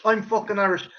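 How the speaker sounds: ordinary talk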